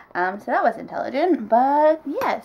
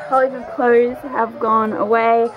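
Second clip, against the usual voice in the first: ordinary talk, nothing marked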